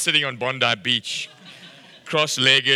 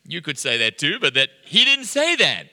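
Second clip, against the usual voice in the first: ordinary talk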